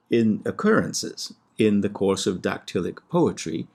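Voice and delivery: ordinary talk, nothing marked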